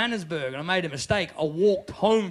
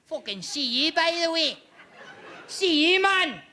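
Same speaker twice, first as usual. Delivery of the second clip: put-on sinister Glasgow accent; 5 semitones higher than usual. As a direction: threaten in a broad Glasgow accent. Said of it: "If you wanna be sinister".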